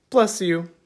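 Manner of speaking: usual speaking voice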